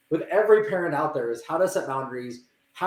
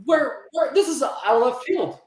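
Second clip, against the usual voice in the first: higher-pitched, blindsided protest; bewildered; 7 semitones higher, slower than usual. Retold or imitated. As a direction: sound caught completely off guard. Voicing a child blindsided by correction.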